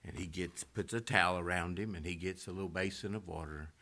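ordinary talk

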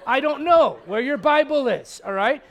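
loud, slow, emphatic exasperation; exasperated parent. Acted. Parent fed up with a child's lost Bible, exasperated. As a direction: spell it out to a kid who keeps asking. Parent snaps back at kid.

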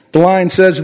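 normal talking voice

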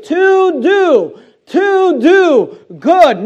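"to do" very high, loud and drawn out; stress on TO DO. Shown as a demonstration. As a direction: hammer "to do" slowly, each word on its own. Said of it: "notice the emphasis".

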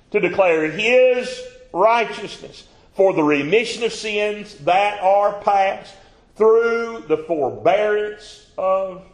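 high, loud, slow scripture reading; preacher reading Romans at full force; preaching scripture. Real, not acted. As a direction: proclaim the verse slowly.

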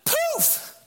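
very high, loud burst; big poof. Acted. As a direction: pop the word Poof like magic. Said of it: "the poof's in the Hebrew".

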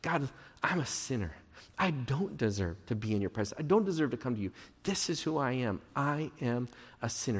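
soft, subdued prayer of a sinner; hushed, lowly admission, imitated; humble confession. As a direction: admit it to God humbly, voice well down. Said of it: "in a remarkably humble way".

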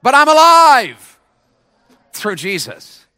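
high, loud, stretched out; big slow shout of alive. Shown as a demonstration. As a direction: drag it out at full voice. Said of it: "say it".

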